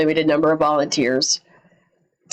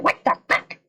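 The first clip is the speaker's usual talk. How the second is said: raspy whispered parrot voice; cockatoo squawk. Retold or imitated. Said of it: "those little cockatoos with the mohawk".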